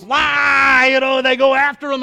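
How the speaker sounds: loud, high mob shout; crowd yell. Retold or imitated. Crowd turning on false prophets.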